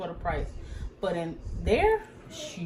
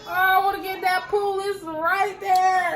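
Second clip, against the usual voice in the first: mock whine about the pool; whiny plea; 9 semitones higher, 3 dB louder than usual. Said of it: "I was so mad".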